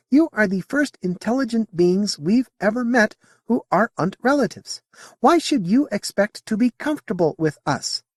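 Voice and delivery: high, lilting alien voice; a curious alien, politely puzzled; alien Modi voice. Acted. Alien answering Sally's question in a high voice.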